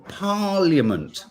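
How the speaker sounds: PARLIAMENT slow and high, with a Y, PAR-lee-ament; habitual pronunciation. Shown as a demonstration. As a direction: stretch out Parliament, sounding the I. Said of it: "I always say parliament".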